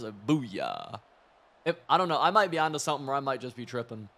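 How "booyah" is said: low, punchy booyah; deep booyah catchphrase. Retold or imitated. Imitating a TV catchphrase to his viewers, lightly deep. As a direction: drop the voice for a mock catchphrase. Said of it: "It's like a real, like, deep voice".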